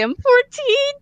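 a nervous squeak on fourteen; voice cracking. Retold or imitated. Nervous teen answering the airport officer, voice cracking high. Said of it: "my voice cracked really bad".

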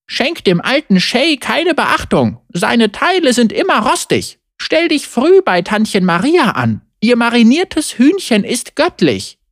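high-pitched grandmother drumming in advice; nagging grandmother. Acted. Grandmother drilling market tips into her grandchild, high and insistent. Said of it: "die ihr die Großmutter eingetrichtert hatte".

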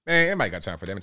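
normal talking voice

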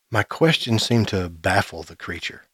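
ordinary delivery, nothing marked

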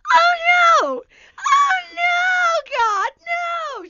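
very high, loud wailing as mom; mock anguished wail. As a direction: scream it like a distraught mom, hammed up. Acts mother screaming over cat.

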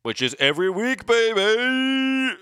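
hyped-up podcast host voice. Pretend. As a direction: sell it like a radio promo. Podcast sign-off hype voice.

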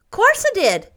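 put-on high, loud, unabashed child; frank little boy. A narrator's reading. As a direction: own up brightly without shame. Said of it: "said Davy frankly".

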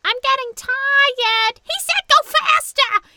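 high squeaky cartoon mice quote; squeaky mice voices. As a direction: squeak it like a tiny skiing mouse. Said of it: "and they were like".

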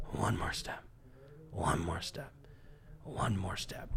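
hushed, breathy whisper to himself; whispered self-talk. Retold or imitated. What he told himself in his head while limping, whispered. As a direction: whisper it to keep yourself moving. Inner voice pushing on.

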